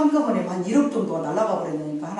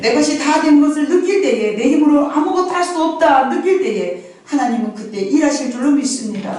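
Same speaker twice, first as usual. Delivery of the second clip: loud, raised preaching voice; full-force preaching; 6 semitones higher, 7 dB louder than usual. Acted. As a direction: proclaim it to the congregation. Preaching to the congregation. (힘찬 설교 톤)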